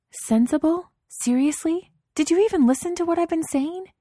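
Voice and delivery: high, loud incredulous outrage; outraged disbelief. A narrator's reading. Wife hurling her husband's word back at him, outraged.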